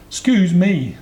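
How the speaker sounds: put-on high, loud childlike call; elephant's child. A narrator's reading. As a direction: pipe up as an eager young elephant. Small elephant child addresses the snake.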